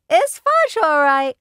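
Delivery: put-on high, loud little-kid echo; a toddler parroting his big brother proudly. A narrator's reading. Fudge repeats his brother's words to Mom in a high loud toddler voice. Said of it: "my brother repeated".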